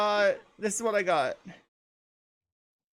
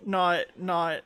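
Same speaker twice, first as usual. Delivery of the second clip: usual speaking voice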